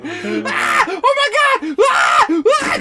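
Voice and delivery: high panicked shrieks, hammed up; mock screaming. Stepmom screaming at thirty on the freeway, hammed-up shrieks. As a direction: shriek like a terrified driver. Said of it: "just screaming".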